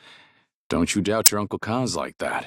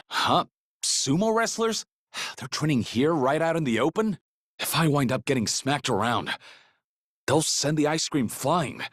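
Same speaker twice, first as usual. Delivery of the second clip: startled; 7 semitones higher than usual. Acted. Startled by sumo wrestlers.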